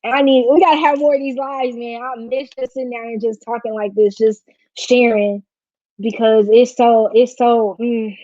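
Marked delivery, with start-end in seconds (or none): none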